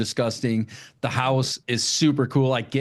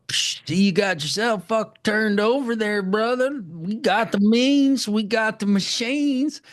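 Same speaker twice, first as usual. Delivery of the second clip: high-pitched movie character impression, drawn out at the end; high hammed-up quote, stretching the last phrases; 9 semitones higher than usual. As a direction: play the eager roadside helper, pitched way up. Acting out Tinker's boastful offer.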